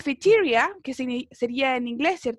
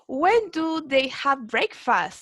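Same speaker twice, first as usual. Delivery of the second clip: slow, carefully spaced English question; slow model reading; much slower than usual. Shown as a demonstration. Teacher modelling the question for her class, slow and clear. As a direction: read it slowly so the class can follow. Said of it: "Aquí ya me están preguntando cuándo".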